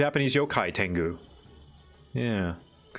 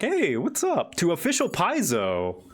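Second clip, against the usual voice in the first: excited; 7 semitones higher than usual. Real, not acted. Greeting a viewer.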